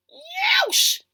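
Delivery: sharp high yelp of pain; high, loud mock ouch. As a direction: yelp like you stepped on Lego. Treading on Lego.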